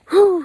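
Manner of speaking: big loud relieved whew. Real, not acted. Relief after crossing line.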